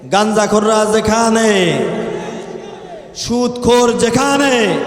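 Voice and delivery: loud, slow call-out to the crowd; fiery waaz opening, slow and loud; preacher's rally call. Real, not acted. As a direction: rouse the crowd with each line.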